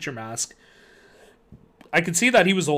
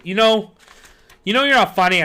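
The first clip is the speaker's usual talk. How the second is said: louder, irritated snap; snapping; 5 semitones higher, 7 dB louder than usual. A narrator's reading. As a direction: snap at him. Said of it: "I snapped".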